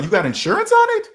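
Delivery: high-pitched mock shock; acted squawk of astonishment; incredulous question. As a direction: leap up high in disbelief. Acts shocked reply to phone buyer.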